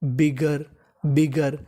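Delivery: "बिगर" low and careful, twice; teacher reading "बिगर" aloud; model pronunciation. Shown as a demonstration. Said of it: "तो meaning बनी".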